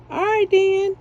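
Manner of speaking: high, loud all right then; bright high exclamation; delighted. Real, not acted. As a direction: squeal over the pretty beads. Admiring her bead soup.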